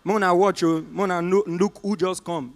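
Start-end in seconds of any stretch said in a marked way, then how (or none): none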